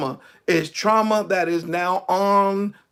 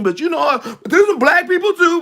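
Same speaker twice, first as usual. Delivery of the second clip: animated, freely voiced imitation after the hush; loud again; 9 semitones higher, 5 dB louder than usual. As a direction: jump up high and loud. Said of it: "they get loud again".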